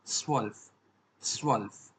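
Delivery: स्वल्फ opening on a sharp ts; German zwölf. Shown as a demonstration. Teacher showing learners twelve, स्वल्फ with sharp ts. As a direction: say स्वल्फ as tsvolf, ending on lf. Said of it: "इसको हम क्या बोलेंगे".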